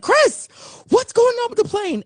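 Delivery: high, urgent call to a friend; calling out worried; alarmed call. Retold or imitated. Calling friend about plane, alarmed.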